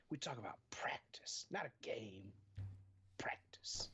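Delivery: hushed mock Allen Iverson rant; whispered Iverson quote.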